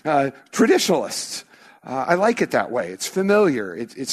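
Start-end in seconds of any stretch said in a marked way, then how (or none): none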